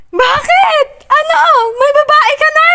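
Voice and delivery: high, loud, strained screaming. Acted. Heroine accusing Achilles of another woman, strained scream. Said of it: "I screamed with my strained voice".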